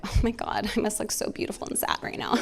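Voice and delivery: hushed, self-admiring aside; a breathy mock-narcissist murmur with a laugh; soft smug self-pity, half laughing; mock vain whisper. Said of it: "I will still be narcissistic and be like".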